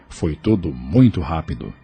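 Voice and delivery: ordinary talk, nothing marked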